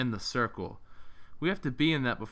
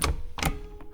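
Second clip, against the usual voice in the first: resigned sigh. Acted. Left with the last house.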